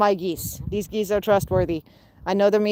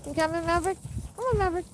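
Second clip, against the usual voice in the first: high sing-song call; calling pets; 9 semitones higher, 6 dB quieter, much faster than usual. Real, not acted. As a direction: coax them in a high voice.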